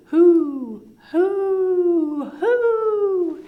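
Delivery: very high, ringing owl hoots. Acted. Mother Owl hooting toward the east, high hoots. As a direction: hoot like an owl waking the sun. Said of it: "and hooted".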